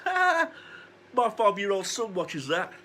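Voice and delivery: high scoffing "Ha!" then teasing line; mocking laugh. Cashier mocks the wrestling fan, high scoffing laugh. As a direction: sneer it with a sharp high laugh. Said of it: "starts laughing and goes".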